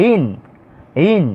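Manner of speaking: ইন begun with a y glide, "yin"; ya pronunciation. Shown as a demonstration. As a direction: lead into ইন with a clear Arabic ya sound. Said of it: "আর ওটা হচ্ছে". (ইন-এর শুরুতে ইয়া ধ্বনি, "ইয়িন")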